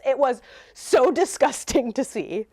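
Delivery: usual speaking voice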